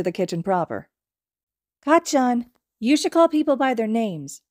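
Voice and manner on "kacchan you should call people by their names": put-on high, loud little-boy lecture; a toddler telling off an adult; scolding child voice.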